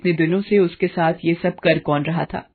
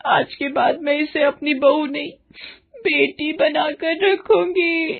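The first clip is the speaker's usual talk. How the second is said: crying plea; 7 semitones higher than usual. Acted. Mother-in-law begs her husband's forgiveness, weeping. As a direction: beg forgiveness through tears, pitch climbing. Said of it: "रोती हुई".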